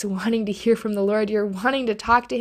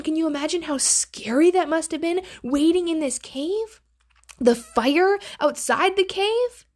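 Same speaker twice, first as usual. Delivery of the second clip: higher, quicker, wide-eyed; excited awe; 7 semitones higher than usual. Real, not acted. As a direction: marvel at how scary it was. Imagining Elijah's terror.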